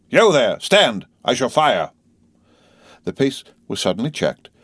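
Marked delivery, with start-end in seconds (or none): 0.1-1.9 1 guard's challenge. A narrator's reading. Guard challenges rider in mist.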